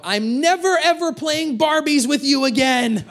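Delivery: high, far-carrying yell imitating an angry kid; mock child's shout. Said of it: "this shout".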